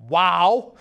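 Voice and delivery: loud stunned wow; amazed wow. Retold or imitated. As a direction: gape at the idea. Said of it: "And I'm thinking".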